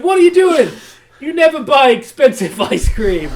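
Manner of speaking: hammed-up disbelief at his friends; shocked shout. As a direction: Shoot yelling in mock shock at friends buying ice cream. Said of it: "And Shoot's like".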